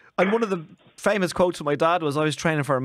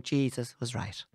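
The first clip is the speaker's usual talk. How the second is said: glum Irish voice; 5 semitones lower, 9 dB quieter, slower than usual. Retold or imitated. Irish people going, mock gloom.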